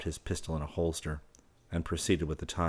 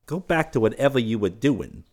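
higher, louder command; giving an order; 6 semitones higher, 6 dB louder than usual. A narrator's reading. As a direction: order them off, pitched up and loud. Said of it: "he ordered".

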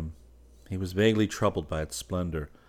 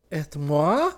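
higher playful call; model crying out; 9 semitones higher, 5 dB louder than usual. A narrator's reading. The model teasingly calling to Selby. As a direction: cry out teasingly. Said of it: "cried the model".

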